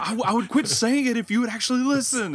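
high, loud exasperated comeback; hammed-up fed-up argument voice; mock crazy angry. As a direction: push the voice up in acted indignation. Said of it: "crazy angry because I'm like".

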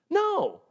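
a high, sharp No; exclamatory rebuttal; emphatic no. Real, not acted. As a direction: answer the question with a flat-out high No. Emphatic answer to own question.

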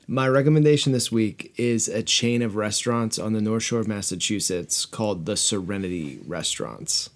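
ordinary talk

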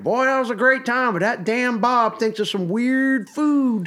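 high, drawn-out imitation of dad; put-on dad voice, slow and high; dad impression. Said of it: "and he'll be like".

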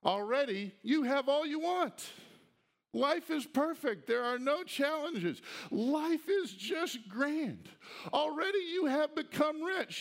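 sarcastic praise of the proud church; sarcasm. Acted. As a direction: lay the flattery on thick and ironic. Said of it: "Y'all do see the sarcasm here".

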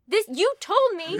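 high, loud incredulous protest; mock indignation. Killer objects to the king's blame, mock indignant.

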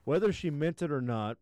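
ordinary talk, nothing marked

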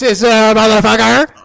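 sudden loud curse; loud outburst. Real, not acted. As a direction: blurt it out loud. Jolts the mic by accident.

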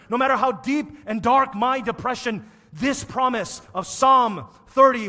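loud, high, building sermon cry; preaching at full force. Acted. Preacher building to a loud climax for the congregation.

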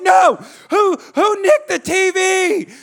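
high, loud, drawn-out yell; acted meltdown at top volume; almost screaming. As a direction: refuse loudly, then stretch out the furious question. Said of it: "I'm almost screaming".

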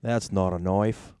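slow, deliberate Australian accent; Crocodile Dundee. Shown as a demonstration. Proving he can mimic, slow Australian accent. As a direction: draw out knife with an Aussie "oy" vowel. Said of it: "example of something you can mimic".

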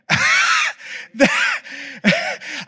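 high shrieking nervous yelp-laugh; panicked yelp. Retold or imitated. Boy caught wearing mom's flip-flops, panicked squeal. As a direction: let out a caught-red-handed squeal.

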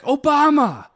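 imitating the owner's high, loud cry of Obama; excited shout. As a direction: blurt it out like a thrilled shopkeeper. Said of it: "he's just like".

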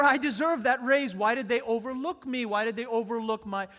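hammed-up wounded entitlement; indignant complaint. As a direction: protest being passed over. Acts an overlooked worker complaining.

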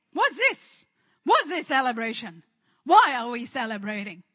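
loud, acted outraged questioning; punchy, affronted questions; indignant elder brother. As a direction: demand an explanation in mock outrage.

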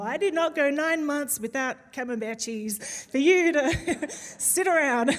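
playful mum's scolding, breaking into laughter; milk the line, then crack up; laughing mock complaint. Preacher imagining being a mum, laughing mock complaint.

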